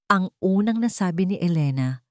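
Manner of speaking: ordinary delivery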